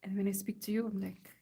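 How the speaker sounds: usual speaking voice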